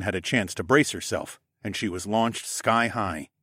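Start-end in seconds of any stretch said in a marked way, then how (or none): none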